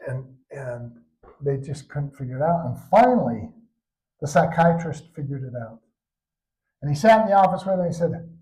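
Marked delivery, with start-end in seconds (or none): none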